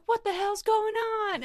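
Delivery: high-pitched, outraged demand; retold alarmed question. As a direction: act out drag queens stepping in. Drag queens' startled question, retold.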